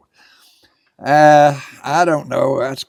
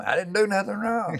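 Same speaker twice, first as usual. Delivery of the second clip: high-pitched whiny-innocent protest; mock protest; 14 semitones higher than usual. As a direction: plead innocence in a much higher voice. Mimics protest of someone blamed.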